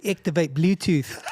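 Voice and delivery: put-on slow, drawn-out Kiwi accent, half laughing. Talking to a New Zealand hire car in a put-on Kiwi accent. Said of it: "I don't even do good accents".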